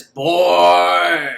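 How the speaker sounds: put-on loud, long drawn-out "boy"; Tall Man tagline. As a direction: boom it like a hammed-up horror villain. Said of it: "his tagline is".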